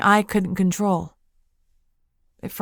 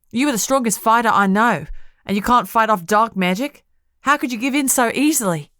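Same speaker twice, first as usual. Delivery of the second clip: angry scolding; 4 semitones higher, 5 dB louder than usual. Acted. Friend scolds her angrily.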